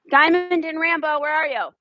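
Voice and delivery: high, loud call across the yard. Real, not acted. Owner calling her missing dogs Diamond and Rambo, loud and high.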